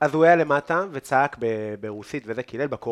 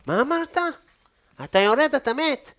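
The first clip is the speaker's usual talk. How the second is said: mock threatening neighbor; 11 semitones higher than usual. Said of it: "הוא אמר לי".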